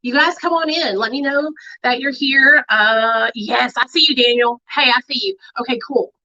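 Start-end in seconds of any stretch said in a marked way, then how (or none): none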